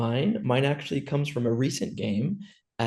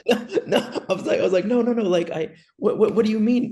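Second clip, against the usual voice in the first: flustered protest; 9 semitones higher, 4 dB louder than usual. Retold or imitated. Protesting the broken promise.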